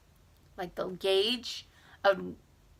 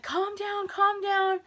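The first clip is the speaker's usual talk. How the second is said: soothing; 11 semitones higher, slower than usual. Retold or imitated. Mom soothing her.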